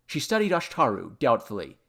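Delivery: ordinary delivery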